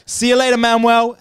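high, loud, gleeful send-off; triumphant farewell. Retold or imitated. Quitting cleaner leaving the manager behind, triumphant call. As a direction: call it out as a victorious parting shot.

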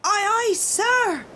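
high, brisk, eager acknowledgement; smart sailor's reply. A narrator's reading. Little man answering his captain, smartly calling out. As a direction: sing it out smartly like a seasoned sailor. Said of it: "just as smartly as if".

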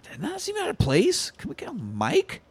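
hushed whispered aside. Retold or imitated. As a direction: whisper it conspiratorially. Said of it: "And then Jesus, like".

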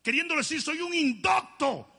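put-on high, loud pleading child-like protest; Jeremiah protesting. Jeremiah pleading with God that he is only a child, high and loud. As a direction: cry out that you're only a boy. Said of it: "Y Jeremías dice".

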